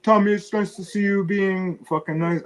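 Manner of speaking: high, put-on voice mocking the chat; mocking chatters. Host mimics his chat praising him, in a high mocking voice. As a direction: sneer it out like a snide viewer. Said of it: "the guys in your chat were like".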